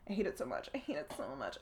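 very quiet, weary exasperation; sinking to a near-mumble; exasperated aside. Real, not acted. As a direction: say it under your breath, fed up. Exasperated aside after a groan.

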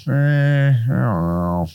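unconvinced hum, drop into a doubtful mutter; a hint of a shrugging Jesus; doubtful shrug. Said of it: "Jesus was kinda like".

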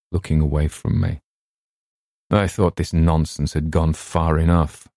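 usual speaking voice

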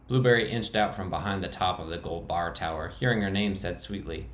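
plain reading voice

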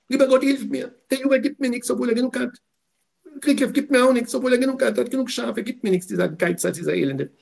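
high, loud pleading widow's cry; widow crying to God. Acted. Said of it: "sie schreit". (hohe, laute Klage der Witwe zu Gott)

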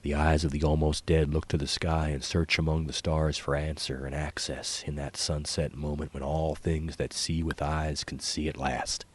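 ordinary delivery

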